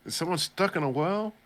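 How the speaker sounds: high, cooing talk to a pet; mock Lassie owner. Teasing the scared rabbit like Lassie, high cooing. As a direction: ham it up like talking to a dog. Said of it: "He's like".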